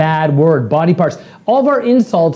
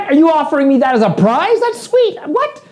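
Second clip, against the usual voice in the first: mock delighted disbelief; 9 semitones higher than usual. Speaker answering an insult as if offered a prize, mock delighted disbelief. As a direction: pitch climbing far up into an incredulous squeak.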